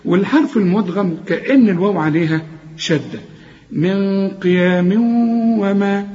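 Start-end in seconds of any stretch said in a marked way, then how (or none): 3.7-6.1 very slow من قيام وما with long nasal hums; held ghunna. Shown as a demonstration. Teacher recites to show merging, held ghunna. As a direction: hold the nasal merge into the waw of وما. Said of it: "محتاجين برضو غنة".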